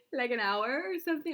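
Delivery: bursts into a high laugh and talks through it; real giggle at her own hour of self-blame. Real, not acted. Admitting she cried for an hour.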